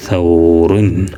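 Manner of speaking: സൗറുൻ stretched out very slowly; slow model reading. Shown as a demonstration. Teacher repeats the word slowly so pupils hear it. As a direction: draw out സൗറുൻ for the learners. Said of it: "വായിച്ച് നോക്കുമ്പോൾ".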